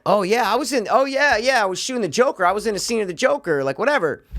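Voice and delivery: tossed-off bragging impression; imitating Brian Callen. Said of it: "he's been talking about".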